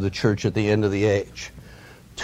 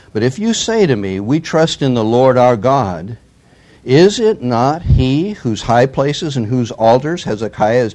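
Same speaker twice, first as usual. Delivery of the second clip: loud, declaiming the envoy's taunt; forceful scripture reading; 3 semitones higher, 6 dB louder than usual. Acted. Assyrian envoy taunting Jerusalem, read out loud and forceful. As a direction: proclaim it like a herald's challenge.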